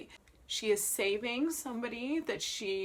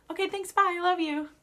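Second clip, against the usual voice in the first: high, loud mock-sweet goodbye; breezy sign-off; 9 semitones higher, 7 dB louder than usual. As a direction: toss off a chirpy farewell as jealous Tosca. Acts Tosca's breezy sign-off.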